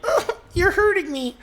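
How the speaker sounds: high, pained melodramatic whimper; Lifetime movie victim. Retold or imitated. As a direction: ham up a movie victim in pain. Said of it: "she'll be like".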